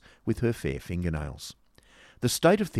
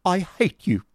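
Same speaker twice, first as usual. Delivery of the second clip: loud, high-pitched declaration; high, dramatic outburst of hatred; indignant dignity; 11 semitones higher, 7 dB louder than usual. Acted. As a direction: declare it hotly to your rival. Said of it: "exclaimed Miss Squeers with dignity".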